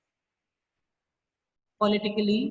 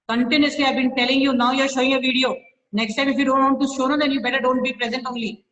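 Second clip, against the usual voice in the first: higher, louder, fast rebuke; angry scolding; 4 semitones higher, 5 dB louder, faster than usual. Real, not acted. Teacher scolding a student who hides his video, fast angry rebuke. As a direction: fire off a rapid angry telling-off.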